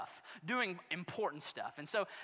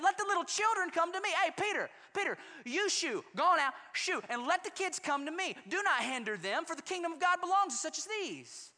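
ticked-off Jesus; 10 semitones higher than usual. Acted. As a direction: set it off on the disciples. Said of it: "I'm about to set it off on these dudes".

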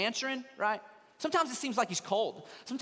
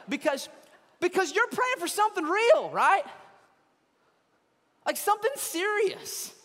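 impassioned preaching; 6 semitones higher, 5 dB louder than usual. Real, not acted. Preacher pleading for those who pray for healing, impassioned and loud. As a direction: preach it with full force, stretching key words.

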